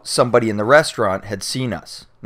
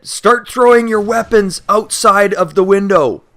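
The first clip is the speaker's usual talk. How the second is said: cop order; 9 semitones higher, 6 dB louder than usual. Retold or imitated. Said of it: "they said".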